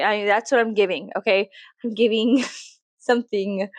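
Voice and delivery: ordinary talk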